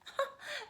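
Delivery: high short chuckle; a bright little laugh. Acted. Grandmother laughs at Whiskers.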